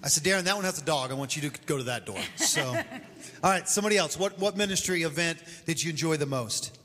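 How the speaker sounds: usual speaking voice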